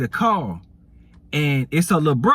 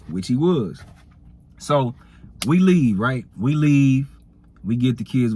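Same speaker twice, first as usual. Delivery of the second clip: normal talking voice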